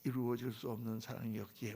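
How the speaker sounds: low, soft quote of a love song; understated, low-pitched lovelorn lyric; quoted pop lyric. Retold or imitated. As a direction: murmur the lyric to yourself. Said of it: "모든 유행가가 자기 노래잖아요".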